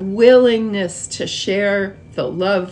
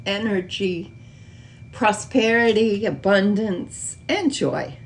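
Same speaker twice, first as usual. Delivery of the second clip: guided meditation; 7 dB quieter than usual. Acted. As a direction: gently lead them into stillness, softer and unhurried. Leads a slow meditation.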